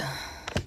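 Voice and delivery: short, soft exhaled sigh; resigned sigh. Retold or imitated. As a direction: sigh as if giving up. Resigned sigh imitating bridesmaids giving up.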